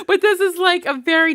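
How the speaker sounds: high loud laugh spilling into words. Real, not acted. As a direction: laugh through the start of the sentence. Laughing at her own joke.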